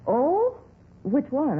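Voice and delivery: nosy landlady, startled and curious; surprised. Acted. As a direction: jump up in surprise. Landlady hears police want tenant.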